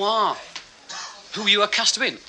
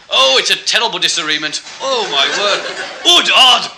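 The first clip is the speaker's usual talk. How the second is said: high, loud plummy commentator voice dropping G's; laughing through a posh football commentary; Alf Ramsey impression; 7 semitones higher, 8 dB louder than usual. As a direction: commentate like a well-spoken old manager, hamming it. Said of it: "he talks like that, ... never sounds his G's".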